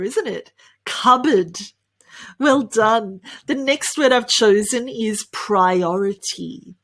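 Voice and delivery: usual speaking voice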